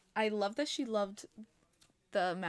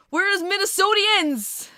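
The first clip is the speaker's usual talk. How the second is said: loud, high, slow yell into the chat; calling the viewers out; shouted call-out; 11 semitones higher, 13 dB louder, slower than usual. Real, not acted.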